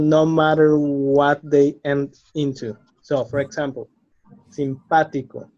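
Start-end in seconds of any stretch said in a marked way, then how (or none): 4.5-5.5 simpático said sim-PÁ-tico; antepenultimate stress. Shown as a demonstration. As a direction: hit the PÁ in "simpático". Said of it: "is the third syllable from the".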